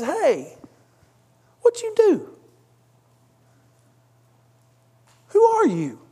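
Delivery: high-pitched startled captain. Retold or imitated. Preacher voicing captain shaking Jonah awake, high startled. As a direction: squeak it out like a shocked captain.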